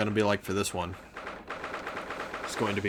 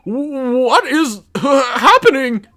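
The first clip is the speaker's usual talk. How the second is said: loud, high, drawn-out cry; stammer, then yell it slowly in disbelief; high bewildered shout; stunned outburst; 12 dB louder, much slower than usual. Acted. Character's shocked outburst.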